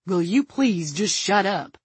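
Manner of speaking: slow, measured exasperation; exasperated. A narrator's reading. Gunman tells screaming companion off, exasperated. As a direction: space the words out, fed up.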